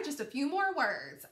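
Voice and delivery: ordinary talk, nothing marked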